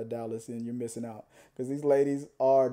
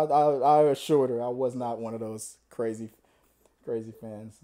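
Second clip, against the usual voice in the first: normal talking voice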